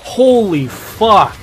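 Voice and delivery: slow, loud, awed curse; amazed, drawn out. Real, not acted. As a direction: stretch it out in awe. Streamer amazed by new UI.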